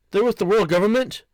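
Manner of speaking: usual speaking voice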